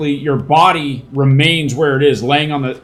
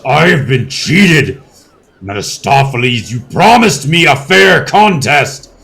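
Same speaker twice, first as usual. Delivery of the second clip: louder outraged herald; cheated herald; 5 dB louder than usual. Acted. Herald accuses Mephistopheles of cheating, loud and outraged. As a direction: protest the broken deal.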